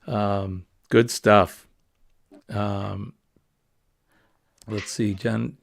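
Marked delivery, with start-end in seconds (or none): 0.9-1.7 light Maine accent, a bit louder. Shown as a demonstration. Vlogger carrying on his Maine accent bit for viewers. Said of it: "as we say".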